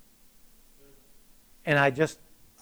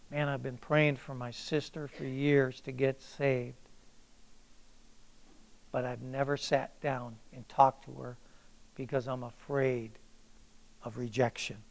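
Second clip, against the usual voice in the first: hesitant confession; 2 semitones lower, 10 dB quieter than usual. Acted. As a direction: confess it quietly, as if admitting a fear. Voices a listener's hesitant confession.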